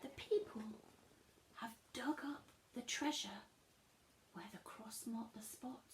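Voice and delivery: soft conspiratorial whisper. Real, not acted. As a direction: whisper it like a secret. Secret aside.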